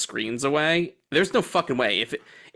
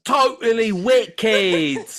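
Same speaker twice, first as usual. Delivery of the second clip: mock British kid; 7 semitones higher, 4 dB louder, much slower than usual. Keeps mocking the British kids.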